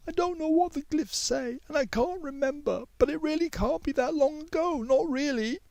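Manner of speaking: timid schoolgirl checking in; girl Mei Yi. A narrator's reading.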